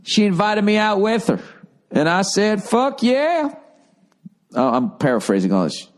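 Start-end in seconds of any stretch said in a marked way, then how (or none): 0.0-3.5 jokey Southern twang, climbing high at the end; silly Southern accent. Acted. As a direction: read the letter as a hammed-up Southerner. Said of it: "your attempt at a Southern accent".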